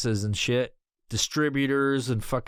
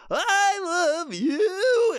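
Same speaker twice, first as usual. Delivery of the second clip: high, loud, crying plea; weak skinned Barney; 3 dB louder, much slower than usual. Retold or imitated. Mocking the host as a weeping skinned Barney, high and crying. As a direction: sob it out like a wounded kids' mascot. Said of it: "he's weak".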